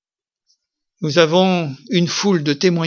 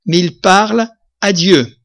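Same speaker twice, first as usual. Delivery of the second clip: slow, louder proclamation of Paul's words; solemn scripture quote; 3 semitones higher, 6 dB louder than usual. Retold or imitated. Preacher reads Paul's verse to his church, slow and solemn. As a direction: declaim the verse slowly and gravely. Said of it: "il dira ceci".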